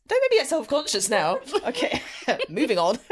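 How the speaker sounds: high, loud, laughing plea; laughing self-consciously. Real, not acted. Host teased about a lisp, laughing in protest. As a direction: laugh it off and push on.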